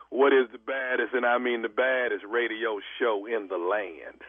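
radio announcer voice. Acted. Radio host promo line.

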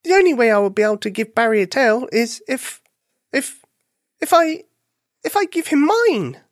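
high-pitched, halting, dismayed bunny; sad bunny. A narrator's reading. Bunny realising he must give his tail, sad. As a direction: falter on the dawning thought, high and sad. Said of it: "Buffy thought sadly".

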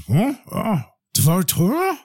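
slow, puzzled through a mouthful; mouth full of bread. Acted. Feivel answers the rebbe with bread in his mouth, mumbled and slow. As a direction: talk around a bite of bread. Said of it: "Feivel's got some bread in his mouth".

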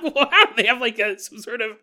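high and loud, laughing as you mock them; laughing mock quote; laughing through a quote. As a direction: giggle your way through the captors' line. Mocking quoted captors, laughing.

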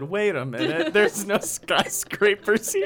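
lightly voiced dumbfounded kid, slowing down; a hint of a naive kid noticing; imitating the kid. Driver mocks kid's first thought, lightly imitated. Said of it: "when he said".

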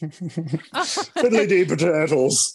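very slow, hammed-up fake Irish accent; a leprechaun-style stage-Irish accent, laughing through it; mock stage-Irish accent. Mocking Tom Cruise's Irish accent, slow hammy stage-Irish voice. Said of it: "what it's like when I pretend to be a leprechaun".

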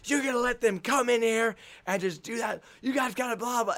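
high, heated locker-room rant; fired-up coach. Retold or imitated. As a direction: imitate a coach laying into his team. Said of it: "Charlie is freaking fired up".

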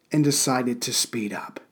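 hushed sarcastic aside; whispered aside. Acted. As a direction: drop to a dry, secretive whisper. Dry aside about bored escorts.

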